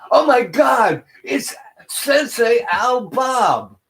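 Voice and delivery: high, loud excited gasp of recognition, slowing on the name; a fan who can't believe who's sitting there; starstruck fan shout. Retold or imitated. Fan spots him in the restaurant, shrieks excitedly.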